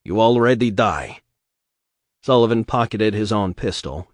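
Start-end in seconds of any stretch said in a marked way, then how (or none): 0.0-1.2 put-on thick Russian accent, cold and blunt; Russian accent, dry threat. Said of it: "said in his Russian accent".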